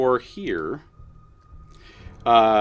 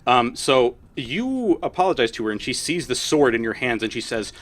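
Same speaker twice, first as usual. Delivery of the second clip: ordinary delivery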